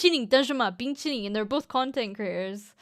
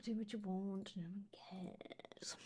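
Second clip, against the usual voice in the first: whisper; 4 semitones lower, 20 dB quieter than usual. Real, not acted. Sarcastic aside to chat.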